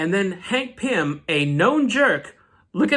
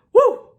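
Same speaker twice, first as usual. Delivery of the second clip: loud, high whooping cheer; loud high woo; 8 dB louder than usual. Real, not acted. Fan cheering the action-packed ending, loud high whoop. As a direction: let out a big joyful whoop.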